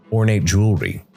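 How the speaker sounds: ordinary delivery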